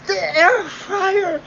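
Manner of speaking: hammed-up amazed "The air fryer!"; mock exclamation. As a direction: stretch "The air fryer!" in fake wonder.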